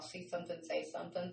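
soft ad-slogan echo; quiet slogan. Shown as a demonstration. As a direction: murmur the ad line under the breath. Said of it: "that's a commercial".

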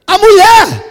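high, loud startled exclamation; excited surprise. Retold or imitated. Preacher spotting the woman at the airport, loud and high. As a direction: blurt it out in amazement.

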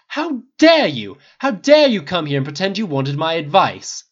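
slow, high, loud indignation; outraged. A narrator's reading. Snape accusing Harry of pretence, outraged. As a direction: stretch out the outrage on the first words.

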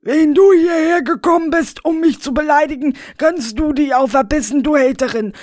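high, loud, fast offended retort; indignant Rainer. Acted. Rainer insulted by the woman, high indignant retort. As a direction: snap back, offended.

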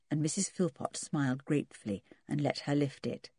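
ordinary delivery

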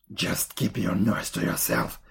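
low, put-on old woman's voice; scolding witch. A narrator's reading. Old woman silencing the weeping Gretel, low and scolding.

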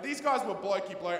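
ordinary delivery

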